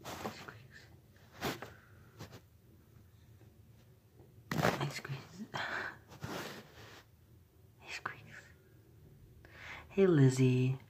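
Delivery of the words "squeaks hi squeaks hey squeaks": whispering. Real, not acted. Whispering to pet.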